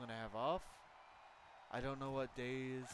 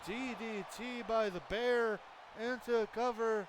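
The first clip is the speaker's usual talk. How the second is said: excited wrestling commentary; live play-by-play; 4 semitones higher than usual. Acted. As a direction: call the match like a ringside announcer.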